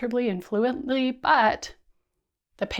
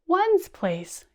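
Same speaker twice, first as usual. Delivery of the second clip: smallest voice; 9 semitones higher than usual. Shown as a demonstration. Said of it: "using our hands and our voices".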